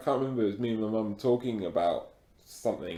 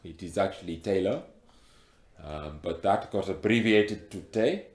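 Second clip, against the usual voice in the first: put-on heavy African accent; 4 semitones lower than usual. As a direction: speak with a thick African accent. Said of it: "you've got a very thick accent".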